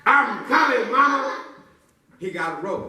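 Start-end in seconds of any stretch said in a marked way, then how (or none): 0.1-1.4 high, eager call to mama; brave little boy. Acted. Boy shouting back to his mama. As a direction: call out like a heroic kid. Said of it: "That little boy said".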